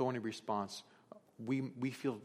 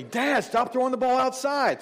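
put-on high, loud whiny kid yell; loud kid complaint, pitched high; 12 semitones higher, 9 dB louder than usual. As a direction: mimic an annoyed kid shouting at dad. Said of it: "so they yell at me".

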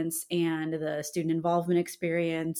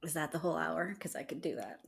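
whisper; 11 dB quieter than usual. Real, not acted. Joking aside to host.

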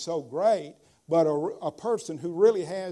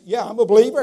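mock boast; 6 semitones higher, 13 dB louder, much faster than usual. Mocking a careless believer.

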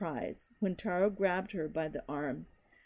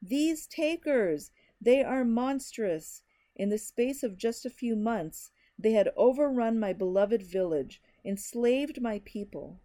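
raised voice; 3 semitones higher, 4 dB louder than usual. Acted. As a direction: raise your voice to the crowd. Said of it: "raising his voice".

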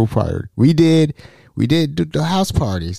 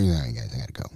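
quiet hurried exit; 13 semitones lower, 11 dB quieter, much faster than usual. Retold or imitated. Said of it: "he hears".

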